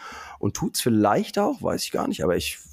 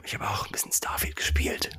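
secretive whisper; 4 semitones lower, 8 dB quieter than usual. Real, not acted. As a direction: whisper it so nobody hears. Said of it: "Warum flüsterst du?".

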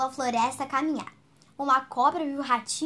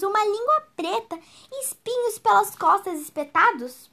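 high, bright-eyed mouse piling up scary details; wide-eyed little mouse; 7 semitones higher, 6 dB louder than usual. Acted. Mouse describing a monster to the snake, wide-eyed. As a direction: paint the monster with growing wonder.

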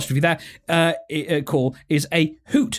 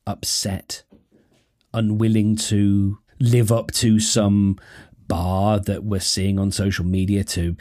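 sincere and calming; 7 semitones lower than usual. Real, not acted. Host talking directly to the listener who feels upset, sincere and calming. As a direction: drop low and speak gently, with measured pauses.

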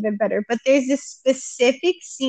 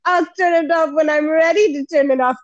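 mock stubborn old man, higher pitch; Saul; 4 semitones higher than usual. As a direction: put on a grumpy refusal to obey. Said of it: "And Saul goes".

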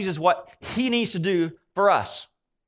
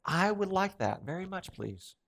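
softly acted polite plea; quiet wish; 8 dB quieter, slower than usual. Voicing a worn-out listener in the room, quiet wishing. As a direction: wish for it softly, trailing down on please.

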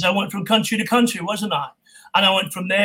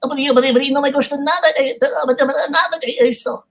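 higher, stammering cartoon pig in Spanish; Spanish Porky Pig; 6 semitones higher, 3 dB louder, faster than usual. Shown as a demonstration. Voice actor demoing Porky Pig dubbed in Spanish, higher stammer. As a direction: sputter it out like Porky. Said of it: "I did all the Porky Pig and everybody in Spanish".

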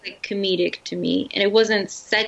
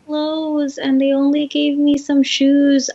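mock naive girl voice; 5 semitones higher, 3 dB louder than usual. Mocking the naive heroine.

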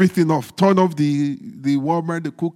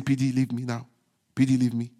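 softer mock begging; mock plea; 7 semitones lower, 8 dB quieter, faster than usual. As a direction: imitate a sleepy plea to be left alone. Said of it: "Someone is saying".

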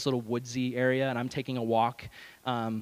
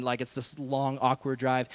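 ordinary talk

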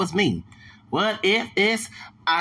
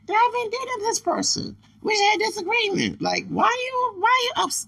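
raised-pitch storytelling; high voice. Retold or imitated. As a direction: talk way up high. High retelling voice.

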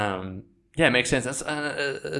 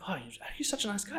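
mock surprised fan; 9 semitones higher, 10 dB quieter, faster than usual. Quoting surprised followers.

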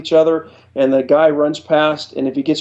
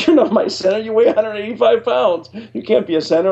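lightly acted, higher-pitched disbelieving coach; mock disbelief, pitched up; incredulous coach; 7 semitones higher than usual. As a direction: imitate a coach scoffing in a raised pitch. Retold coach rejecting him, incredulous.

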